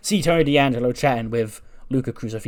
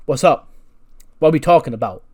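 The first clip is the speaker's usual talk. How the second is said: louder, slightly lifted acted greeting; loud butting in; 3 semitones higher, 6 dB louder than usual. R-Truth barges into Tony and Luca's chat, loudly. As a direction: burst into the conversation.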